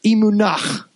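"emunach", e-MOO-nakh, ending on a rasping kh; emunach, guttural kh ending like Hebrew; Hebrew word emunach. Shown as a demonstration. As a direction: say "emunach" with a throaty kh at the end. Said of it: "let's get Hebrew".

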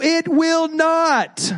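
preacher flatly refusing the myth; emphatic no. Acted. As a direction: stretch "it will not" high and slow. Denying the soulmate myth.

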